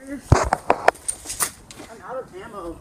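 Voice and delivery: high, loud, drawn-out call. Real, not acted. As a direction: shout it across the field. Calling out in the game.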